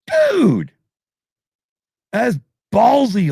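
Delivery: high, loud, amazed cry; stunned disbelief. Retold or imitated. Watching James tempt the lightning, in loud high awe. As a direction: gasp it out in awe at the singer's nerve. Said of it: "I'm like".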